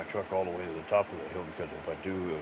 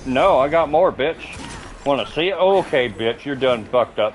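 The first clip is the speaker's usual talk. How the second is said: loud, higher taunting shout; real trash-talk yell; 6 semitones higher, 10 dB louder than usual. Real, not acted. Streamer fighting a game enemy, loud taunting shout. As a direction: bark it at the enemy you are beating.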